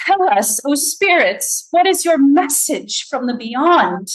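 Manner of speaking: slow, high theatrical call to spirits; dramatic medium. A narrator's reading. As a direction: summon the spirits with grand drama. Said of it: "says dramatically".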